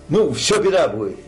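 normal talking voice

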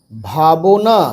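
ভাবনা slow, with the middle vowel sounded; broken into syllables. Shown as a demonstration. Teacher spelling out a word for beginners, syllable by syllable. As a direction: stretch ভাবনা out as bha-bo-na. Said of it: "ভেঙে ভেঙে পড়বে".

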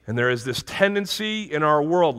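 usual speaking voice

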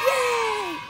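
high happy shout; excited cheer. Real, not acted. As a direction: cheer like you just won. Cheering after landing.

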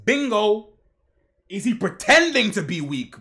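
loud, thrilled cry of bingo; hyped-up loud reaction; excited shout. Real, not acted. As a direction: yell it like you called it.

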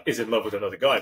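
normal talking voice

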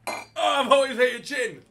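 loud, high wail of disgust; disgusted cry. Real, not acted. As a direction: yell through the burn of gin. Reacting to downing a gin shot.

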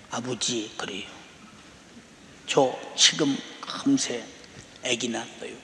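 low, hushed, drained new mother; retold tearful daughter. Daughter phones her father after giving birth, retold tearful and hushed. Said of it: "계속 울기만 해요".

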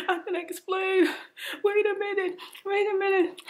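hammed-up frantic boyfriend; imitating his panicked yell. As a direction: play him begging her to wait. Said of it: "He yelling".

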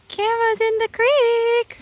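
high, slow excited squeal; thrilled high call as the horse wades in. Real, not acted. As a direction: squeal it out with delight. Excited call as horse enters creek.

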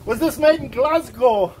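put-on slow, playful Scottish accent; hammed-up Scottish accent. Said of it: "you can do your Scottish accent again".

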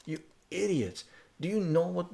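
retold furious rant. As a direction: tear into them all over again. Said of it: "I go".

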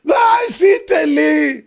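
loud, high, drawn-out lament; wailing complaint. Acted. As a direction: cry it slowly to heaven, aggrieved. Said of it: "אבל מה האדם רואה?". (זעקת תלונה ממושכת)

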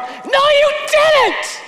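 high, slow, loud scream of denial. Retold or imitated. Pastor screaming at God in his grief, high and loud.

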